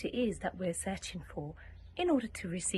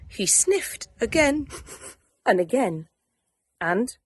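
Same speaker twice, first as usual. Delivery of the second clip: ordinary delivery, nothing marked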